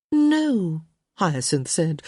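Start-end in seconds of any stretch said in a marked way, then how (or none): 0.1-0.8 exasperated. A narrator's reading. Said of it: "with a roll of her eyes".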